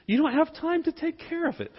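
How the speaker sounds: high-pitched imitation of wife refusing; mock wifely no, pitched well up; lightly scolding retold refusal; objecting wife. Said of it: "she said".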